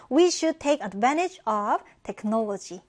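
slow, clear example read; slow model sentence. Shown as a demonstration. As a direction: read it slowly for learners. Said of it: "이용을 잘해야 돼요". (천천히 예문 읽기)

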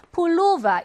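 폴로바 with a loud, high 로; stress on second syllable. Shown as a demonstration. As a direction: punch the middle 로 in 폴로바. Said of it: "이 음절 강세어예요".

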